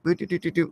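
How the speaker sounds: soft mouth-made arcade game death jingle; quiet run of game-over blips; Pac-Man death sound. Retold or imitated. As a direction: imitate Pac-Man dying, softly. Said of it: "when Pac-Man dies and went like".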